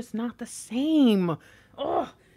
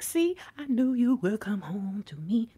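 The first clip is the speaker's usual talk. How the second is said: knowing whispered aside; acted whisper. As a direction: whisper it as the Divine Feminine. Voicing the Divine Feminine to partner.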